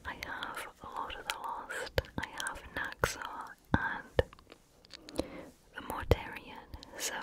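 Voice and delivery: ordinary talk, nothing marked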